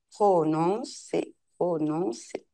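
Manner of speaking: slow, syllable by syllable; careful articulation. Shown as a demonstration. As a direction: spell out prononcer slowly. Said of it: "Bien articulé et prononcer". (prononcer lentement, syllabe par syllabe)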